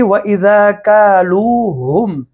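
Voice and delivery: slow, melodic quote of the verse; slow recitation. Shown as a demonstration. Teacher quotes the verse slowly for students. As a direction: chant it slowly to show. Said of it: "अब आप ये देखिए".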